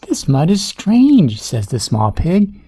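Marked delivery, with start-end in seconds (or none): 0.1-1.4 high-pitched dawning alarm; puzzled pig. A narrator's reading. Small pig notices odd mud, puzzled and uneasy.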